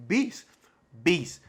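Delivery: ordinary talk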